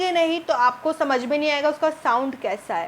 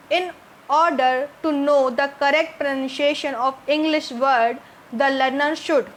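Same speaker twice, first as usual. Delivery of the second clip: slow, careful reading of the English question; much slower than usual. Shown as a demonstration. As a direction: read the question out word by word. Reads the question aloud. (अंग्रेज़ी प्रश्न धीरे-धीरे पढ़ना)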